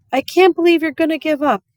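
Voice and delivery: put-on a girl refusing to quit on her dog; upset protest. Opal to her daddy, refusing to give up, high and loud.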